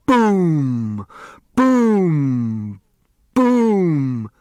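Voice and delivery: booming firework sound. A narrator's reading. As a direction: boom it out like a rocket bursting. Fireworks exploding.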